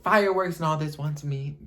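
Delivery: high, loud exclamation; theatrical outrage. Real, not acted. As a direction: list it in mock indignation. Outraged recap of the bombshell.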